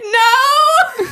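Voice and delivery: a high, laughing-crying no; despairing no. Retold or imitated. Hearing the vet's message again, a high laughing wail of no. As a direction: wail it as the plan collapses.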